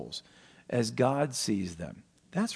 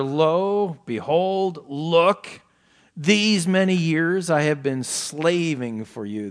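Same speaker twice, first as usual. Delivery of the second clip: mildly bitter, drawn-out protest; bitter complaint; 4 semitones higher, 5 dB louder, slower than usual. Retold or imitated. Elder son protests to his father about slaving, bitter. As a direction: lean resentfully on the long years. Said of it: "is there bitterness there".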